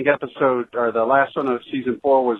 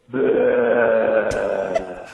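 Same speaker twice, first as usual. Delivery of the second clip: groaning undead moan; zombie voice; 4 semitones lower than usual. Acted. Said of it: "Here's my zombie voice".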